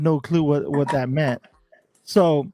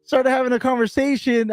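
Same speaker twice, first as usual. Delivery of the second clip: exasperated protest; 8 semitones higher than usual. Retold or imitated. Insisting to security he only sat down, higher in disbelief. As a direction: plead innocence, half laughing.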